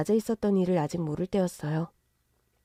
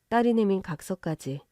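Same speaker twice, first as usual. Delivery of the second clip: usual speaking voice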